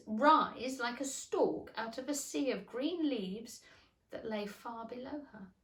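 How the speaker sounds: plain reading voice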